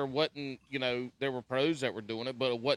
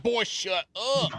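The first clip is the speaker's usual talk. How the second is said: higher, slow mock scolding; grandpa scolding; 7 semitones higher, 3 dB louder, slower than usual. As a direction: imitate a grandpa shushing a boy, stretched out. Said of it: "Your grandpa's like".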